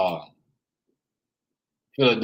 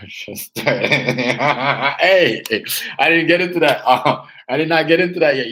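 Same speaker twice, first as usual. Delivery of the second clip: laughing; 7 dB louder, slower than usual. Real, not acted. Laughing off a chat question.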